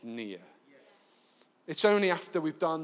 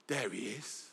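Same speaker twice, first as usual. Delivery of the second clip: gasp, then a hushed, slow "there he is"; sudden quiet wonder at recognizing him; awed gasp; 6 semitones lower, 7 dB quieter than usual. Acted. Disciples seeing Jesus at the table, hushed awe.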